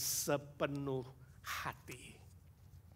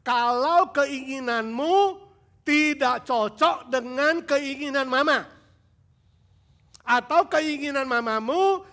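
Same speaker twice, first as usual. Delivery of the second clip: loud, high sermon voice, slow at first; fervent preacher pressing the congregation; preaching at full force; 8 semitones higher, 10 dB louder than usual. Acted. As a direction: preach it hard to the youth. Preaching to young people.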